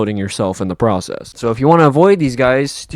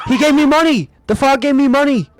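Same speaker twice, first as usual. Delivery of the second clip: high-pitched thrilled squeal; excited exclamation; 13 semitones higher than usual. Real, not acted. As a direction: cry out in delighted amazement. Amazed that frog drops money.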